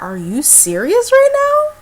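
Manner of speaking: high, slow, loud disbelieving cry; disbelief. Retold or imitated. To friend inviting a stranger over, high slow disbelief. Friend met him on Quest.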